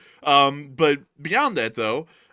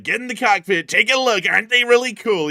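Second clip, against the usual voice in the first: high, loud boastful tour-guide voice; Putin showing off jets; 9 semitones higher, 9 dB louder than usual. Pretend. Putin showing jets to visitors, bragging loudly. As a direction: show off the planes like an eager host. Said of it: "he could be like".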